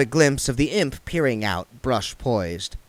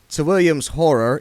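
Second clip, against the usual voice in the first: ordinary delivery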